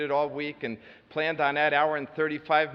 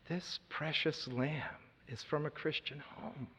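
soft, slow and hushed; tender inner thought; 12 dB quieter than usual. Retold or imitated. Realizing who his seatmate is, hushed and tender. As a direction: let it fall quietly, touched.

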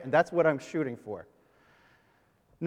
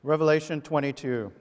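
normal talking voice

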